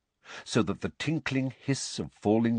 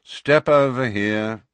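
slow, bored, louder drawl; 3 semitones higher, 7 dB louder, slower than usual. Acted. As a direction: say it like you've said it all day. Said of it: "said the wizard in a bored voice".

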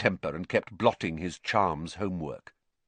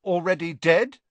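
higher, louder helpful suggestion; helpful prompt; 9 semitones higher, 5 dB louder than usual. Acted. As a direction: chip in helpfully. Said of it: "said Ron helpfully".

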